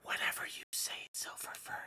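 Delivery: mocking breathy whisper; soft teasing whisper. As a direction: whisper it close to his face, teasing. Pats cheek, mocking intimately.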